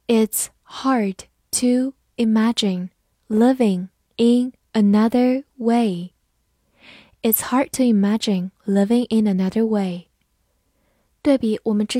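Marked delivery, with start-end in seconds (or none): 0.1-6.1 slow, word by word; slow, deliberate teaching pace; slow read-through. Shown as a demonstration. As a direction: read it slowly with pauses between words. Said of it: "慢读一遍".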